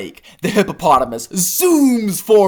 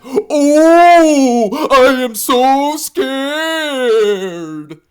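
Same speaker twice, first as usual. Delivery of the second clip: loud high mock fear, drawn very slow; sarcastic; 11 semitones higher, 6 dB louder, much slower than usual. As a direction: stretch it out dripping with sarcasm. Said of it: "the alligator sarcastically says".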